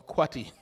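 kwati said the Jamaican patois way, as KWAH-tee; Jamaican word. Shown as a demonstration. Preacher tells the church the Jamaican patois word kwati. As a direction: drop kwati in like a Jamaican would. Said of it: "what Jamaicans would call kwati".

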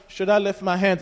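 unsure, half-hearted asking; hesitant question. Shown as a demonstration. Preacher mimics a timid worshipper to the congregation, hesitant. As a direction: ask it tentatively, without conviction. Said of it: "it's not like".

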